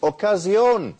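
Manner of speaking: high, loud market call announcing a sale; vendor's cry. Retold or imitated. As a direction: call out to the passing crowd like a street hawker. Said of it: "he cries out".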